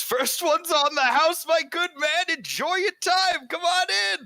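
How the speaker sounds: very high, loud, welcoming innkeeper voice; Glenn the innkeeper. Acted. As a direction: push the free beer on them, beaming. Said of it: "and he says".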